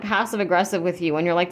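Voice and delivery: ordinary delivery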